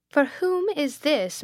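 slow, high, icily formal question; awful coldness. A narrator's reading. Headmistress to her sister over the second dictionary, with awful coldness. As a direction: headmistress questioning with cold disapproval. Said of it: "said Miss Pinkerton with awful coldness".

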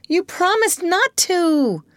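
put-on very high, drawn-out boyish complaint; slow, high-pitched protest; hurt protest. A narrator's reading. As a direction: accuse her like a let-down child. Peter protests at grown-up Wendy.

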